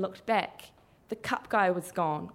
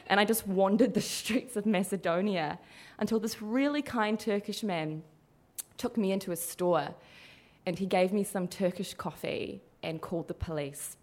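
ordinary talk

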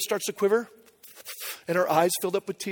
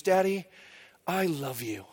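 soft, low imitation of a loving little girl; hushed retold "I love you"; daughter's tender declaration; 3 semitones lower, 8 dB quieter, slower than usual. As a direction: retell it softly and earnestly, like a child confiding. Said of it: "she looks into my eyes and she goes".

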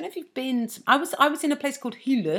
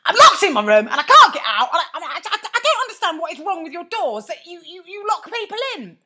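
high, loud, flustered outrage; spluttering angry complaint; aggressive; 9 semitones higher, 9 dB louder than usual. Retold or imitated. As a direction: demand answers from reception. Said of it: "some might say aggressively".

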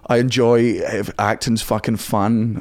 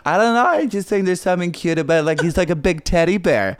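higher, girlish American put-on; female American accent; 5 semitones higher than usual. American woman explaining her crush, put-on female accent. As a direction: gush as a mock American woman. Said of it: "I can only do female American accent".

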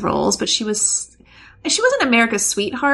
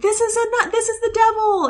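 alarmed; 12 semitones higher than usual. Retold or imitated. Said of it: "you're like".

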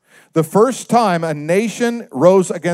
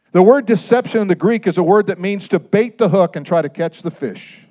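ordinary talk

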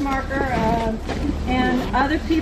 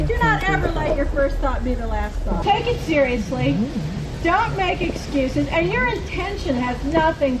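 preaching; 5 semitones higher than usual. Acted. Carolyn preaching at her tent revival.

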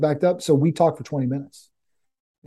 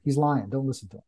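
ordinary talk, nothing marked

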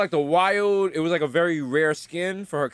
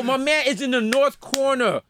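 higher, emphatic callout to teammates; exasperated directions; 5 semitones higher, slower than usual. Acted. Player giving clueless teammates directions, exasperated. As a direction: spell it out slowly, hammed-up exasperation. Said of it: "trying to give directions on Call of Duty".

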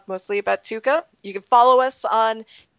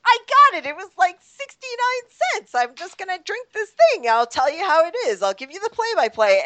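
high, eager and giddy; pumped up to try it; excited; 9 semitones higher than usual. Retold or imitated. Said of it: "I was like".

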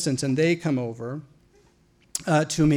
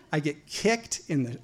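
ordinary delivery

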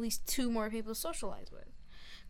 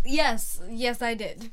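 mock passionate yes; 10 dB louder, slower than usual. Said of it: "Are you like".

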